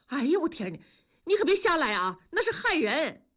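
high-pitched, dismayed warning; alarmed pleading. A narrator's reading. Husband begging his wife not to harm anyone, alarmed. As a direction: beg her not to go through with it.

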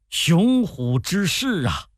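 high, drawn-out exclamation of admiration; awed praise. A narrator's reading. Guest praising his host's mighty army, awed praise. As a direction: marvel aloud, stretching the words. Jiang Gan praises the troops.

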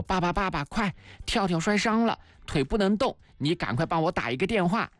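put-on a touch of urgency, like a child calling on dad; mildly pressing storybook plea; urgent child's appeal. A narrator's reading. Child urgently asks dad for help.

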